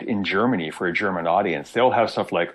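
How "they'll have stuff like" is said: ordinary talk, nothing marked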